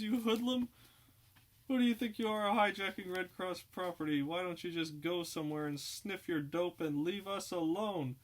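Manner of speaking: high, loud, indignant scolding; outraged scolding. Acted. Girl scolding the hijackers, high outraged voice. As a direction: shame the hoodlum. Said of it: "she screams".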